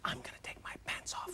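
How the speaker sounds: barely audible, fully whispered; secretive whispered reply for comic effect; answering whisper. Retold or imitated. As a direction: confide it under your breath. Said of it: "she whispers to me".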